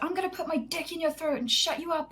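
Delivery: high-pitched mock angry rant; aggressive teammate imitated. Retelling a teammate's threats at her, mock aggressive screaming. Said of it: "would not stop screaming at me".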